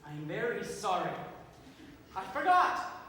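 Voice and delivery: low, bitter mock apology; wounded sarcasm. Playwright to the mocking audience, bitterly sarcastic apology. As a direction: apologise with icy, hurt irony.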